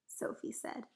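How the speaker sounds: ordinary delivery, nothing marked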